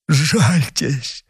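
high, thin cry for pity; feeble plea. Acted. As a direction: beg weakly, voice lifted. Dying man begs for pity.

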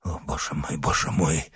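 very soft, low groan of a dying man. A narrator's reading. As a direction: moan it weakly, almost without voice. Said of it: "стонал палач".

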